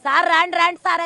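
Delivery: loud, high cry begging them to come; shouting for help as her husband lies below; desperate call for help. Retold or imitated. Widow re-enacting her cries for help to the men, loud and high. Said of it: "అంటే".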